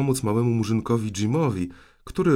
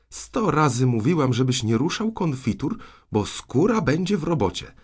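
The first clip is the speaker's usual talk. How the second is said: high, worked-up telling-off; raised voice of an aunt laying down the law; scolding threat; 6 semitones higher, 3 dB louder than usual. Acted. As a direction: scold the child with mounting exasperation.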